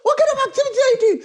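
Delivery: high, fast laughing imitation; mock him with a squeaky, hurried voice; mocking his complaint. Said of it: "And he's like".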